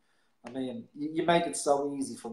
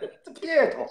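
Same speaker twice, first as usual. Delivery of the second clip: laughing; 13 semitones higher, 7 dB louder than usual. Real, not acted. Laughing at the accent joke.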